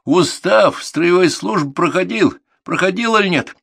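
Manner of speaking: loud, high, drawn-out interrogation; angry grilling. A narrator's reading. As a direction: demand an answer from a pupil. Instructor grills the defiant boy.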